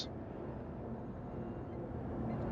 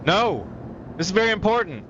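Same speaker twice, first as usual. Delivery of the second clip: urgent shout; 10 semitones higher, faster than usual. Real, not acted. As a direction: shout it out in alarm. Urgent outcry at stream.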